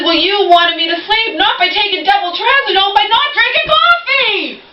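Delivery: exasperated mock quote; mocking yell. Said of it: "I'm yelling on this".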